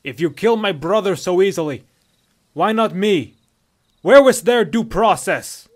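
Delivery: loud, high, outraged Nord; husky Nordic protest, slowing at the end; husky Nordic captive. A narrator's reading. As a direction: shout the challenge at your captors. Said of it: "This voice was husky and distinctly Nordic".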